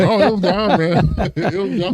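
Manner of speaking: high-pitched words through laughter; laughing admission. Real, not acted. Player joking about his vanished animosity, laughing. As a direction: gleefully concede the point, laughing.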